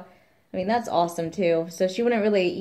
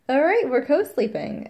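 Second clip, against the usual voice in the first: higher, louder decisive declaration; firm announcement; 8 semitones higher, 5 dB louder than usual. Retold or imitated. Telling James about co-sleeping, in a high firm declaration. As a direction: settle it once and for all.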